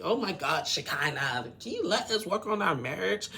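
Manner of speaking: mimicked exasperated snapping; Rebecca. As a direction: act out snapping at an interfering friend. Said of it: "Rebecca snaps at Shekinah".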